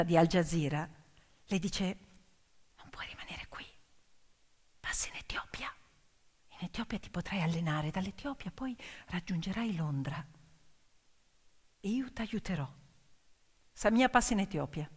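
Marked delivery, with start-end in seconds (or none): 2.8-12.7 soft, urgent whisper; whispered advice. Acted. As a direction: whisper it like a secret escape plan. Journalist's secret advice to Samia.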